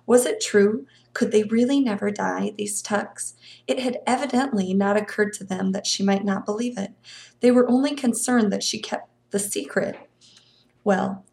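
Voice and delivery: ordinary delivery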